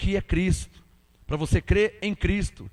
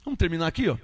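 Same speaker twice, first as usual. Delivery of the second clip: ordinary talk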